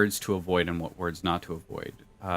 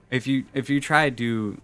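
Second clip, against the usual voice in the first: normal talking voice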